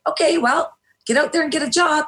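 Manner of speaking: high-pitched imitation of unhelpful advice; mock dismissive. People telling a struggling woman to find work, mock dismissive. Acted quote of unsympathetic people.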